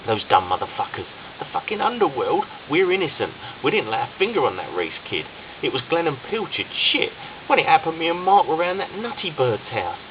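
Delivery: scared. A narrator's reading. Ryan hiding in the bathroom, scared and panicked. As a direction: voice climbing in terror. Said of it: "never felt so scared".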